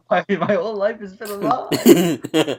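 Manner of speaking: hammed-up crisis, breaking into laughter; mock shocked realization. Friend imitating a YouTuber finding out Blue's a girl, mock shock. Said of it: "and he was like".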